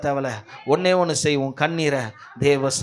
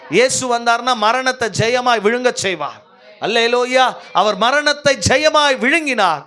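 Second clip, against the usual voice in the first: high, forceful proclamation of victory; triumphant preaching; 7 semitones higher, 5 dB louder than usual. Real, not acted. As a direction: declare it to the whole congregation. (வெற்றி முழக்கமான பிரசங்கம்)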